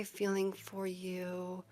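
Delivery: hushed whisper, tuning in. Real, not acted. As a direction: whisper the question softly, repeating it. Tuning into a tarot reading.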